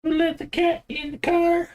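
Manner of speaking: very high squeaky pet-talk question; squeaky baby talk. Pretend. Teasing the cat about a cat in the racing car, very high squeak.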